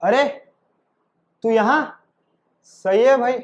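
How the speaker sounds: ordinary talk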